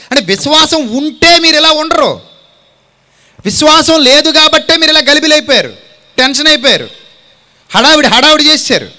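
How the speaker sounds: loud, high rebuke to the congregation; fiery preaching. Acted. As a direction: hammer it home from the pulpit. Preaching at full force.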